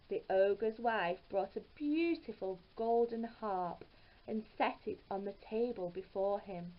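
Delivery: usual speaking voice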